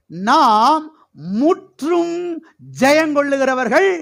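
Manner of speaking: high, ringing declaration in separate bursts; triumphant proclamation. Real, not acted. Preacher telling church they are conquerors, triumphant proclamation.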